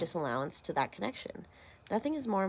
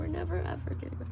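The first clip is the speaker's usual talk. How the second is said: whispered; 9 semitones higher, 4 dB quieter, faster than usual. Pretend. Whispered aside.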